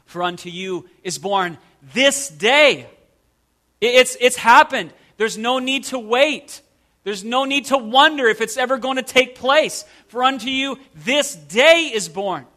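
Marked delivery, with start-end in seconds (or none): 0.1-2.9 pause, then hit "this day"; stress on "this day". Shown as a demonstration. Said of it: "emphasizing different words of". 10.1-12.5 "this day" lifted and punched; stress on "this day". Shown as a demonstration. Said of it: "emphasizing different words of".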